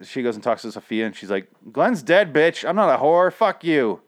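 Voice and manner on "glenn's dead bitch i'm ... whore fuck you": higher, punchy mock-angry comeback; abrasive retort. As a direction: fire back a defiant retort, lightly acted. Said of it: "she says a little more tenderly".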